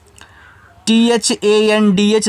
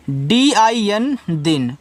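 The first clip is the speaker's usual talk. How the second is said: slow letter-by-letter spelling; teacher spelling out a word for students; much slower than usual. Shown as a demonstration. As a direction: spell out each letter slowly and deliberately. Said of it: "तो डी से दा".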